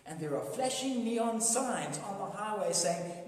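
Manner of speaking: ordinary talk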